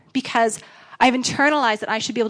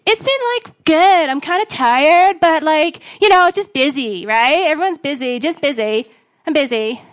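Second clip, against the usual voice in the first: higher, put-on casual cover; pretending all's fine; 4 semitones higher, 3 dB louder than usual. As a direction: start high and loud, settle into evasive small talk. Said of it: "you just straight up lie".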